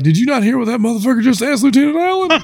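fast, high disbelieving blurt; 1 incredulous whisper-rush. Retold or imitated. Telling Tyler about the question, high fast disbelief. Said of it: "And I was like".

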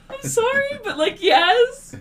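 very high, drawn-out reluctant apology; squeamish apology. Retold or imitated. Agent to outraged reveler, high apologetic yes.

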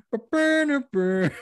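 high, slow sung sax imitation; mimicked saxophone riff.

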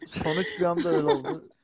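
laughing through the words; giggling while explaining. Real, not acted.

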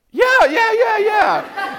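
very high, loud rapid yeses; mock overeager agreement; eager yes. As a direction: jump at the chance to pray. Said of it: "eager agreement".

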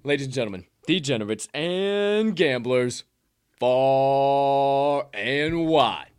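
hyping up the degenerates and gamblers; show sign-off. Acted. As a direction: ring out the closing like a ring announcer. Podcast sign-off announcer voice.

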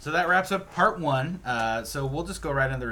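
host outro. Acted. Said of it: "host wrap-up".

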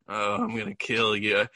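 mock a threat with barely any feeling; almost emotionless. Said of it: "Almost emotionless in, in a way".

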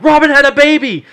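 high, loud blurted announcement; panicked high-pitched shout; frantic yell. Acted. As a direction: holler the shocking news. Yells shocking news at dad.

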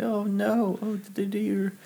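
high, meek little voice; fretful little piglet; timid piglet. Retold or imitated. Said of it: "a little piglet".

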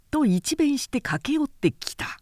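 ordinary delivery, nothing marked

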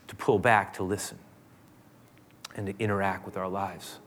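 soft, quiet prayer voice; closing prayer. Acted. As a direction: pray gently over the congregation.

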